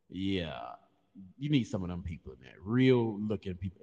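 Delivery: usual speaking voice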